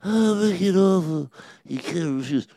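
mumbled Godfather rasp; Marlon Brando impression. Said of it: "he famously says".

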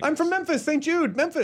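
high, eager recognition; bursting in with delight; excited. Real, not acted. Learns of a Saint Jude event.

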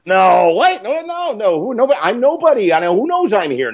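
high, fast flustered protest; panicked disbelief. Retold or imitated. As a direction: protest in a rush that nobody knows you're here. Young writer panicking at the call.

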